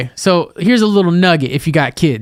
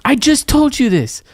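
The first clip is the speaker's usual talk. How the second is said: exasperated parent; 10 semitones higher than usual. Retold or imitated.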